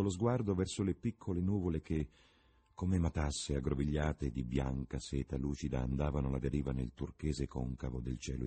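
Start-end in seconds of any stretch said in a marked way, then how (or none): none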